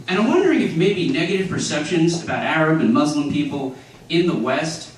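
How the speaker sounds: usual speaking voice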